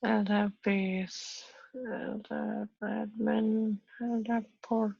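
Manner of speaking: drawn-out, absent read-out of settings; slow reading aloud. Real, not acted. Engineer checking LDAP settings for colleague, slow reading aloud. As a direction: read each setting out slowly to yourself.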